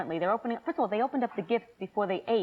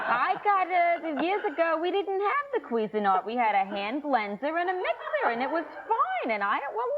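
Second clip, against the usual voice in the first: high, singsong mock aunt; judging aunt; 6 semitones higher than usual. Aunt judging gifts from the back, high disapproving voice. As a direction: imitate a grudging relative, pitched up high. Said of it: "My one aunt sits in the back judging".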